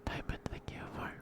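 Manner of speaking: normal talking voice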